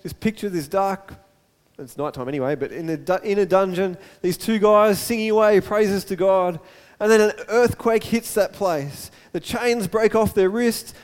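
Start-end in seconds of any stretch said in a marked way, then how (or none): none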